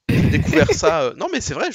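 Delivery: excited outburst. Real, not acted. Surprising discovery about Mab.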